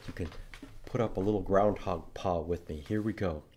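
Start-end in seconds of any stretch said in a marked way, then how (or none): none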